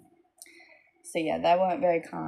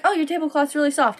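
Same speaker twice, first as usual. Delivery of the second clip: put-on a touch higher, childish gushing; light little girl voice; 8 semitones higher, 4 dB louder than usual. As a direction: lightly play a kid admiring. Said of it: "They're like".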